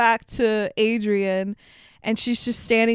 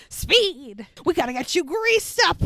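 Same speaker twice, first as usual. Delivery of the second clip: frantic mock coach; 7 semitones higher than usual. Mocking frantic Mickey.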